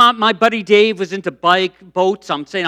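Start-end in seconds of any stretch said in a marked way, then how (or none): none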